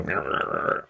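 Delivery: put-on low, soft grumbling growl of an old man; cranky growl. Cranky old man growling at the person holding the door, low and soft. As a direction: growl instead of saying thanks. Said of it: "shuffle through the door and go".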